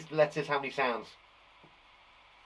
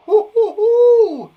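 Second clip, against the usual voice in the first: excited hooting; 15 dB louder, slower than usual. Real, not acted. Teacher cheers the class.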